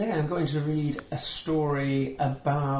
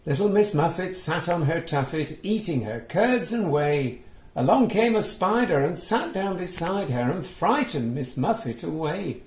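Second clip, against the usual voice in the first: put-on reading to children; 4 semitones higher than usual. A narrator's reading. Reading a picture book aloud to young children, lively storytelling. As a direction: bring the story alive for little listeners. Recites nursery rhyme.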